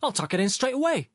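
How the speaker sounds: high-pitched hasty giving-in; upset protest. A narrator's reading. Sharikov promising to tuck napkin, upset. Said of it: "Sharikov protested, upset".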